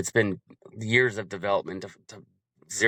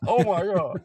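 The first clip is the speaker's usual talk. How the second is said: relieved gasp of pleasure; 7 semitones higher than usual. Retold or imitated. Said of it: "you're like".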